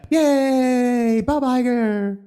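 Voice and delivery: high sarcastic yay; a sarcastic little celebration; mock cheer. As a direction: cheer with fake enthusiasm.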